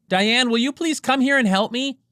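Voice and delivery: high, distant call from outside the room; calling from another room. Acted. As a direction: call out to someone down the hall.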